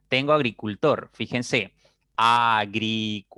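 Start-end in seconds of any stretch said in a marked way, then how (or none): 2.1-3.2 split into syllables. Shown as a demonstration. Teacher has students look at the word, "agricultor" syllable by syllable. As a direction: sound out "agricultor" in four long, level syllables. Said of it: "Tiene cuatro sílabas".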